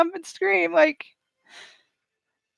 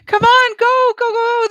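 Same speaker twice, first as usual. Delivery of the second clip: higher, louder urging on; excited cheering; 9 semitones higher, 5 dB louder than usual. Real, not acted. Vlogger cheering racers home, excited and louder. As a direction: cheer the team toward the finish line.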